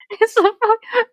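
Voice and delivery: ordinary talk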